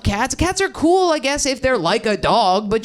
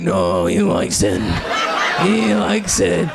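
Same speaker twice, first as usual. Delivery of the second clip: much lower, slow mom voice; imitating mom; 3 semitones lower, much slower than usual. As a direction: drop low and slow like her mom insisting. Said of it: "And you're like".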